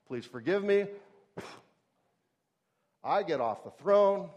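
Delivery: normal talking voice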